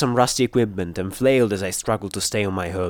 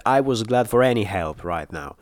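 usual speaking voice

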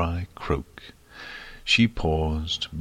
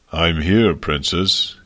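booming dragon voice, much louder; deep and thunderous; 6 dB louder, slower than usual. A narrator's reading. Huge dragon greets the princess from the window, booming. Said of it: "his voice deep and thunderous".

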